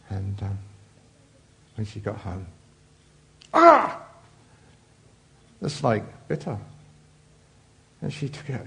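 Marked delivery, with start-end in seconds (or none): 3.5-4.0 high, loud startled yelp; a sudden yelp as she is bitten; sharp cry of pain. Acted. Girl bitten by the snake.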